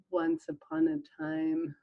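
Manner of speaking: soft, slow, whispered bedtime read; sleep story voice. Shown as a demonstration. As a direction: ease the listener into sleep. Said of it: "listen to mine".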